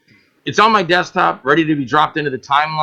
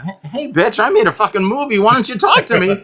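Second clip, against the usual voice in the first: mock indignant retort; 7 semitones higher than usual. Retort to news stations.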